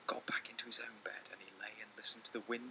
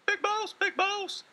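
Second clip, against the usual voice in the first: much higher, louder, slow little voice; calling little mouse; 12 dB louder, slower than usual. Acted. Little Mouse calling his brother at night, high squeaky call. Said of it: "he called".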